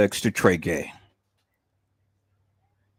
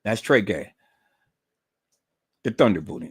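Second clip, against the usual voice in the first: ordinary talk, nothing marked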